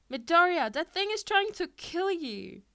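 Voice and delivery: high, loud frightened shout; panicked yell. A narrator's reading. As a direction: scream a warning at him. Classmates panic about snake.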